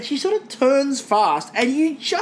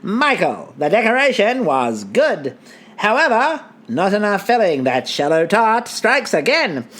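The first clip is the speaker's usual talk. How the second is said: put-on declamatory old-time newsreel voice; theatrical vintage radio delivery; twenties announcer. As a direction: announce the results like a 1920s broadcaster. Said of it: "do the judging in my nineteen twenties voice".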